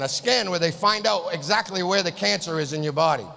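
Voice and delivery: normal talking voice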